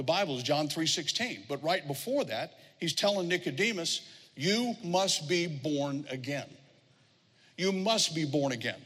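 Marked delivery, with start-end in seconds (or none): 4.3-6.5 word by word, drawn out; slow, deliberate quote. Shown as a demonstration. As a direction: give each word its own weight. First, deliberate take of verse.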